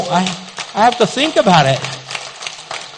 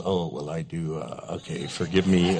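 much softer, lower sheepish reply; sheepish admission; 12 dB quieter than usual. Retold or imitated. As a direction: confess it under your breath. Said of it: "she goes".

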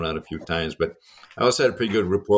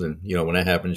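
ordinary talk, nothing marked

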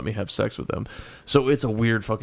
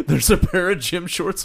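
laughing, higher and louder; 8 semitones higher, 5 dB louder than usual. Real, not acted. Finding another guy's gym shorts in his stuff, laughing higher and louder. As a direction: laugh out the discovery. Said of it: "I'm just laughing".

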